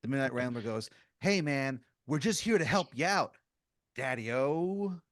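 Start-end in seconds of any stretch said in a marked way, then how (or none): 1.2-5.0 higher, lilting hipster voice; beatnik intruder. Acted. Midnight Rambler offering help, lilting beatnik coaxing. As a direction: coax like a hip cat, stretching the last word.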